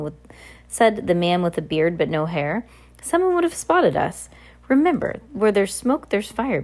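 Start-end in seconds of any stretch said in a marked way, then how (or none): 3.0-6.6 high, thin henchman voice; a high nervous man warning; squeaky henchman voice. Acted. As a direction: pitch the henchman way up.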